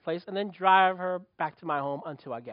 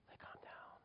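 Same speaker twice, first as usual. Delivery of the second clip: hushed whisper; 32 dB quieter than usual. Shown as a demonstration. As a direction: whisper it like soothing a baby. Showing the baby calming down.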